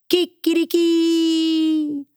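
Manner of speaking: high, slow sung rooster crow; stretched high Kikiriki, sung. Acted. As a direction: crow it out like a proud little rooster. Rooster sings his crow.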